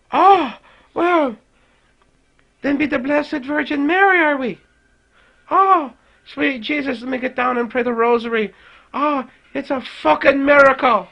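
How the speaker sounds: high, hammed-up mock piety; mocking the teen's virgin birth claim, high and theatrical; dripping sarcasm. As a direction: gush sarcastically like a church lady. Sarcastic mock reply to denial.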